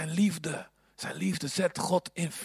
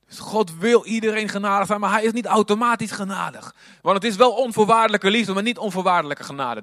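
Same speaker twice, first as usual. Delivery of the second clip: high, loud pulpit emphasis; preacher hammering grace versus love; full-force preaching; 5 semitones higher, 7 dB louder than usual. Acted. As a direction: drive the point home to the congregation.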